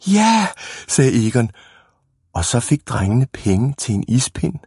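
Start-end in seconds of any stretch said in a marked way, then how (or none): 0.1-0.5 high, delighted little cheer; eager yes. A narrator's reading. Boy offered an ice lolly, high and delighted. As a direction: pipe up eagerly, pitched high.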